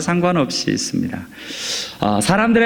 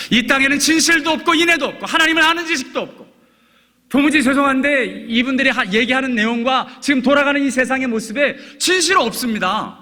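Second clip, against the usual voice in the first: raised, ringing preacher's voice, much higher; fervent preaching; 11 semitones higher than usual. Acted. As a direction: drive the point home from the pulpit. Preaching at full force.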